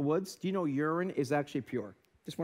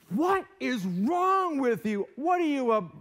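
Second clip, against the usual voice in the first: angry outburst; 7 semitones higher, 4 dB louder, slower than usual. Retold or imitated. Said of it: "So I'm like".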